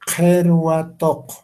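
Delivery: Kerwatok drawn out slowly, each syllable crisp; slow emphasized articulation. Shown as a demonstration. (Kerwatok lento y articulado)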